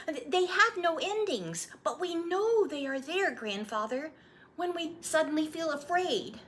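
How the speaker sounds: put-on high, eager child voice; a little boy pleading for the story; young boy. Boy asking his grandfather to retell his birth, eager child voice.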